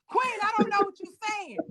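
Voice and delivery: high, loud mock New York accent; attempted New York accent. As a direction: imitate him with a rough New York accent. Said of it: "I can't do a, a New York accent".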